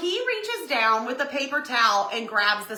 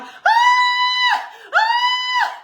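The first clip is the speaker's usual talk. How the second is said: high, loud spider scream; loud high-pitched screams of fright; re-enacted scream; 8 dB louder than usual. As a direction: scream as if spotting a huge spider. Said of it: "I can't even initiate the scream".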